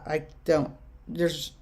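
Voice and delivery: normal talking voice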